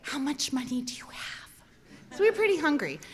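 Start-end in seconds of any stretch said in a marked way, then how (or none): 0.0-1.5 hushed whisper, as if thinking it; furtive whisper. Retold or imitated. Hungry kids wondering among themselves, furtive whisper. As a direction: whisper it under your breath.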